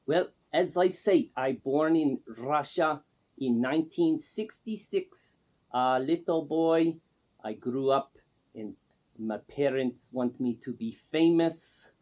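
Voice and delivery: broken English in a put-on Russian accent; Man Gaga's Russian accent. Man Gaga introduces himself to the interviewer in a put-on Russian accent. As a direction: play a self-styled star with a thick fake Russian accent. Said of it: "The first thing I notice is the accent".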